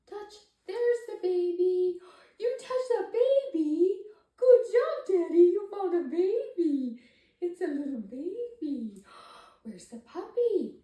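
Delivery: high, loud, delighted cheering; excited praise. Shown as a demonstration. Parent praising baby for finding the picture, loud and high with delight. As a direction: celebrate every touch like a big win. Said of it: "make it interesting using your voice".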